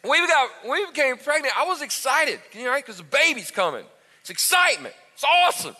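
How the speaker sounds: high, loud bursts of excitement; excited. Retold or imitated. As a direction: shout it out like a thrilled new dad. Excited about the coming baby.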